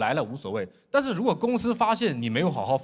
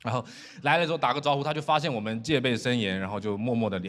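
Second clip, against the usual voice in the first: ordinary delivery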